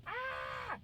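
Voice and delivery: very high, held-back scream; high squeal. Real, not acted. Reacting to creatures infesting the place, high squeal. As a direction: squeal high without full volume.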